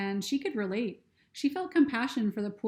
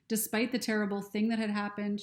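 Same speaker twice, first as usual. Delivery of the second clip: ordinary delivery, nothing marked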